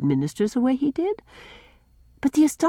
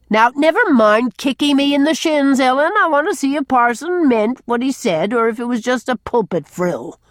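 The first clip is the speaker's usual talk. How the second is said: put-on booming, higher old man bluster; loud blustering; 6 semitones higher, 5 dB louder than usual. A narrator's reading. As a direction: bluster loudly at her. Norman bellows at Ellen.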